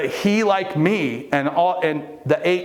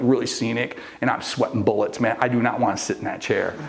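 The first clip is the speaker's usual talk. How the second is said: ordinary delivery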